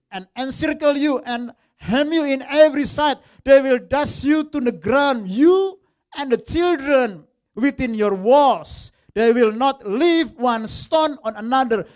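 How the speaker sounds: loud, slow prophetic reading; fiery preaching. Acted. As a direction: thunder each line of doom. Preaches the prophecy at full force. (khotbah berapi-api, keras dan lambat)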